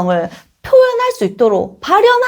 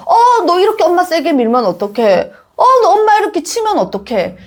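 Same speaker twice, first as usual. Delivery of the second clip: higher-pitched parent objecting; mock parental protest; 7 semitones higher than usual. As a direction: act out a parent taken aback, lightly. Parent's protest, acted as example.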